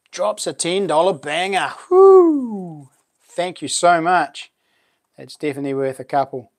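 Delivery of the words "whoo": high, loud cheer of delight; excited whoop. Real, not acted. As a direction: whoop it out for the donation. Cheering a ten dollar donation.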